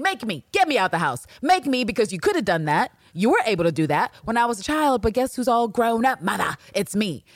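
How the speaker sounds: acted confrontational taunt; Villanelle, aggressive challenge. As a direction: dare her to act, goading. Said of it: "she's being aggressive".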